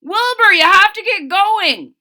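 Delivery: loud urgent shout; high, loud urgent yell. Acted. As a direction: call him loudly to hurry up. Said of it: "shouted Hector".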